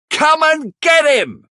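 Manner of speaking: very high, slow, long call; calling out to the crowd. Acted. Charlie alerts the mob outside, very high calling shout. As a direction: shout out the window to the street. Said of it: "he shouts".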